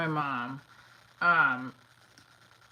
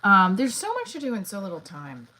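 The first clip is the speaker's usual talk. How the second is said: louder, emphatic complaint; loud exclaim; 3 semitones higher, 10 dB louder than usual. Real, not acted. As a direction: announce it loudly to everyone. Repeats loudly for the viewers.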